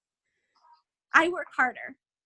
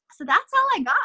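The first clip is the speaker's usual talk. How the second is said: laughing; 8 semitones higher, 7 dB louder than usual. Real, not acted. Ends her talk with laugh.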